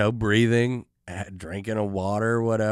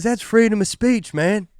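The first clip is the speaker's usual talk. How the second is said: put-on much higher, loud drunken protest; yelling drunk; 11 semitones higher, 5 dB louder than usual. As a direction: shout it like a belligerent drunk. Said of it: "He goes".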